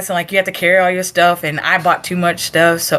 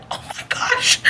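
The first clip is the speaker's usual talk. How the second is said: voiceless, softer aside; mock whispered shock; 6 dB quieter than usual. Recruit yelled at up close, mock whispered shock. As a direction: whisper it as if stunned.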